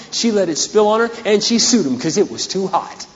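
usual speaking voice

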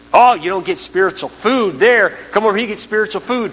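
hammed-up rival preacher; mocking rival church. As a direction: lure people away, mockingly. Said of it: "We have people muttering about us".

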